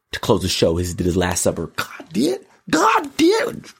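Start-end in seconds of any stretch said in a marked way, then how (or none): 1.8-2.5 high, drawn-out catchphrase. Retold or imitated. 2.7-3.7 very high, crying catchphrase wail. Retold or imitated.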